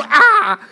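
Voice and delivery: high, loud mock scream of alarm; short loud acted cry of fright. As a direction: let out a sudden imitated shriek. Imitates brothers' alarm at hearing Egypt.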